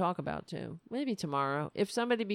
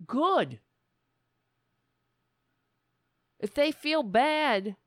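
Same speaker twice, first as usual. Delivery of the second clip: indignant rant; 7 semitones higher, 6 dB louder than usual. Real, not acted. Ranting against apologizing for veganism.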